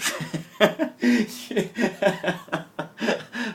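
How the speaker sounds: a long rising giggle fit; can't stop laughing; long high laugh. Real, not acted. Lost somewhere at night, giggling helplessly.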